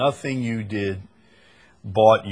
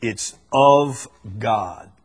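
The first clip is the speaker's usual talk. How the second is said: "of" hit louder, set apart before "God"; stress on OF; 4 dB louder, slower than usual. Shown as a demonstration. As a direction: punch the little word "of". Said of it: "the emphasis is on the two little words".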